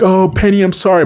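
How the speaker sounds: high-pitched, hammed-up sorry; pleading apology. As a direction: plead with her in a put-on high voice. Acted pleading apology to Penny.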